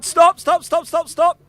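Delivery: loud high stop call; shouting stop. Real, not acted. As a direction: cut them off with shouted stops. Calling time at contest end.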